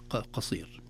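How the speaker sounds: usual speaking voice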